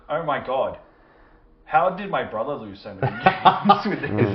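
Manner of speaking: loud, amused disbelief; incredulous exclaim. Retold or imitated. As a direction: laugh it out in disbelief. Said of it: "And you said".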